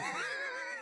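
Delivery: very high squeal of a laugh; squeaky laugh. Real, not acted. Laugh at absurd detail.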